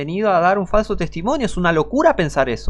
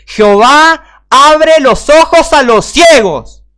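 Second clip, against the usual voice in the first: much higher and louder, very slow on the last words; fiery preaching; 10 semitones higher, 11 dB louder, much slower than usual. Acted. Preacher proclaiming Jesus is the Father, roaring.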